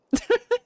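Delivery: high, delighted giggle; real delighted giggle; squealy giggle. Real, not acted. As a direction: let out a giddy squeal. Gushing over cute character.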